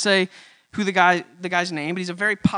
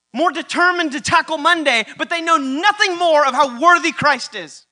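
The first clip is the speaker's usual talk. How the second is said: higher, louder, impassioned sermon climb; full-force preaching; 6 semitones higher, 5 dB louder than usual. Acted. Pastor rebuking the church, higher and louder. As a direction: build it like a revival preacher.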